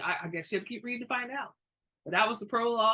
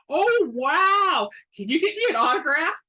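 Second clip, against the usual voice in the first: much higher and louder, fast and eager; starstruck squeal; 9 semitones higher, 10 dB louder than usual. A narrator's reading. Sandra begging Evan for an autograph, thrilled. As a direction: gush like a starstruck fan.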